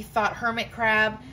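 ordinary delivery, nothing marked

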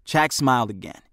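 ordinary delivery

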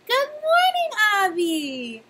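put-on bright high sung-out hello to a child; cheery teacher greeting. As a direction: greet a little boy like a delighted nursery teacher. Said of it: "Mora Sarah would say to him".

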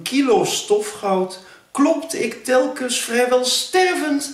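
theatrical poem reading, pitch swelling high; poem recital. Acted. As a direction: declaim the verse with mock-grand pathos. Recites own poem to viewers.

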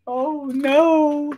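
very high-pitched dismay; theatrical little cry of worry; mock alarm. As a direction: squeal in pretend dread. Storyteller's alarm as troll climbs up.

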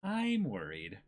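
usual speaking voice

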